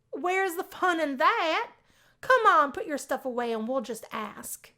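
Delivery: high, loud playful coaxing; eager coaxing. Acted. Sally coaxing Anne to ask Max, high and loud. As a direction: egg her on, won't take no.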